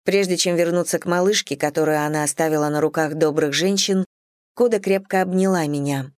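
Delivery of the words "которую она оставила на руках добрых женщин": ordinary delivery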